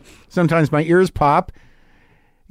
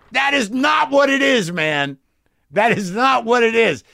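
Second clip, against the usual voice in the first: much higher shouted denial; higher, forceful voice insisting; sharp rebuttal; 6 semitones higher than usual. Acted. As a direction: cut him off hard. Inner voice contradicting him.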